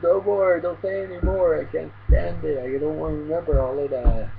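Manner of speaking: high mock-distressed plea, easing down toward the end; distressed pleading. Reviewer acting the girl covering her ears, distressed pleading.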